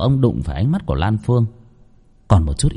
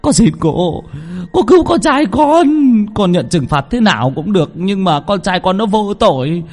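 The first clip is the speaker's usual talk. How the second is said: higher, louder frantic pleading; desperate begging; 10 semitones higher, 3 dB louder than usual. Acted. Father begs the medium to save his son. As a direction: beg for your son's life.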